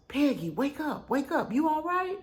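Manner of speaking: mock worried husband, high; imitating him shaking me awake; husband waking me. Husband shaking her awake, mildly worried. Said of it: "and he goes".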